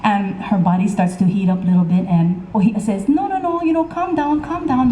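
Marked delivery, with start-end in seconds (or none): 3.1-4.9 nervous pleading. Acted. Ohia soothing angry Pele.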